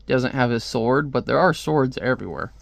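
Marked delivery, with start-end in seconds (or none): none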